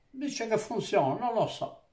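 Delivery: normal talking voice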